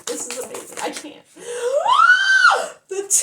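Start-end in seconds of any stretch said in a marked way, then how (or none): 1.3-2.8 huge high shouted gasp; loud gasp. Real, not acted. Reader turns the next card and gasps out loud. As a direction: gasp as if the card shocks you. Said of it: "I'm so hyped and happy".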